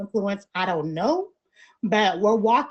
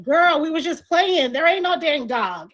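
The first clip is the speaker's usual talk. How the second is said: loud, high teasing dismissal; laughing off the prank; 7 semitones higher, 3 dB louder than usual. Retold or imitated. Friends admit the prank.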